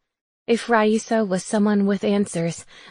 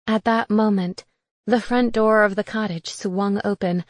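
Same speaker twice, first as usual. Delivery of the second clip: ordinary delivery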